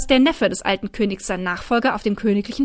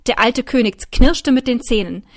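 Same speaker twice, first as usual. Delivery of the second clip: usual speaking voice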